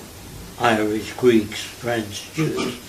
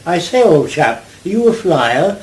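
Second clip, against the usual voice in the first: louder, higher mock upper-class English; posh English accent; 4 semitones higher, 5 dB louder than usual. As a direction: put on a toff's English accent, lightly. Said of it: "That's in England. They speak that way".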